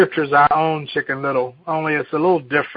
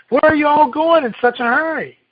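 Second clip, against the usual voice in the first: Turkey Lurkey; 11 semitones higher, 4 dB louder, faster than usual. Acted. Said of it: "Turkey Lurkey".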